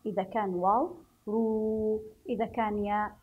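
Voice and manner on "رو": رو with a long, held oo; lengthened vowel. Shown as a demonstration. Teacher showing pupils how waw lengthens the letter, drawn-out رو. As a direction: stretch the waw sound in رو. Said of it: "نمد الحرف على حسبها".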